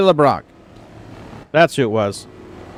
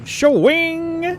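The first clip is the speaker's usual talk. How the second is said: Wayne and Garth. Retold or imitated. Quoting Wayne's World to the chat, high squeal. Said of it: "As Wayne and Garth would say".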